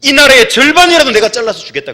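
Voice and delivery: booming king. Acted. Preacher voicing King Ahasuerus to Esther, booming. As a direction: proclaim like a doting king offering half his kingdom.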